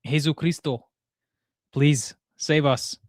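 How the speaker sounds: put-on Spanish-accented Jesucristo, the plea slow; mock Latin American plea; slow pleading Jesucristo, lightly Spanish; Spanish exclamation. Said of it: "As they say in South America".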